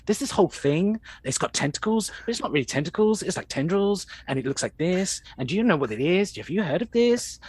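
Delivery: put-on high, eager teenage rush; like a teenager. [name] explaining to aliens like an excited teenager. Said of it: "almost like he's a teenager".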